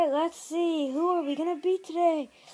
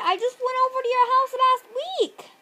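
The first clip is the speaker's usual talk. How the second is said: put-on high, louder cowboy character accent; [name]'s weird accent; 8 semitones higher, 4 dB louder than usual. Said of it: "You talked with that ever since".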